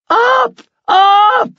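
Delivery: very high, loud frightened shriek. Acted. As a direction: scream in alarm. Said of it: "she shrieked".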